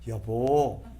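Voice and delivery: the right way to call your spouse, gently; gentle call. Shown as a demonstration. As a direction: say it tenderly. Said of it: "한번 따라해 봅시다".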